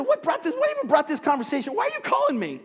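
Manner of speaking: high-pitched mock incredulity; hammed-up incredulous challenge; baffled protest. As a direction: question him in a high, baffled squeal. Acted baffled challenge to brother.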